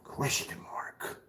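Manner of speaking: mocking whisper of question mark; whispered aside. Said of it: "Are you screaming it like".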